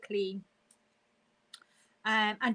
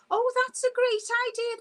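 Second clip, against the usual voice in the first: excited delight; 11 semitones higher, 5 dB louder, faster than usual. Real, not acted. Reacting to a viewer's tip.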